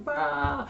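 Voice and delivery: sudden high mock roar; a cartoonish angry "Rah!"; Mister Burns growl. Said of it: "like Mister Burns from The Simpsons".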